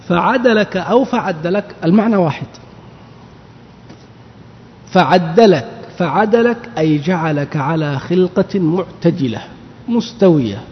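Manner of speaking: usual speaking voice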